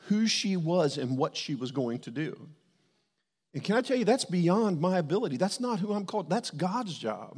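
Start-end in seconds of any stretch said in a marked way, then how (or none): 3.5-7.4 louder, much higher preaching that slows at the end; preaching climax. Real, not acted. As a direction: pastor building to his point before the congregation, loud and high. Preacher builds to a point.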